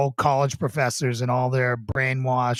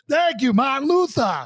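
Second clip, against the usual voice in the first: put-on high, hammy Boston accent; 11 semitones higher than usual. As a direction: thank him in a thick Boston voice. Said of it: "I've shaken my Boston accent".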